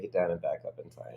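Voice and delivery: hushed whisper to the late guest; whispered aside on air. Real, not acted. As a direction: drop to a breathy whisper while she settles. Guest arrives late, host recaps.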